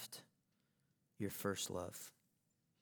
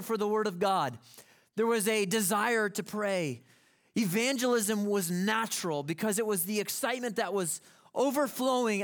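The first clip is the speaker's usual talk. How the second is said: loud, high, soaring sermon delivery; preaching at full force; 9 semitones higher, 10 dB louder than usual. Acted. Pastor describing first love to congregation, full preaching force. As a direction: ride the excitement up and keep pressing.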